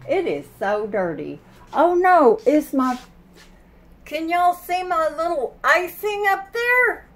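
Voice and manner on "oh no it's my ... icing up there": surprised. Real, not acted. Spots frosting on ceiling.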